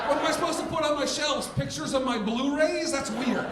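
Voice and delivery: high, pleading defense of the shelves; defensive self. Retold or imitated. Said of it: "I'm like".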